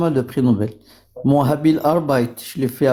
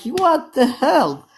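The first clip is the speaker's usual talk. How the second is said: mock outrage; 9 semitones higher, much slower than usual. Mocking a shocked pub customer.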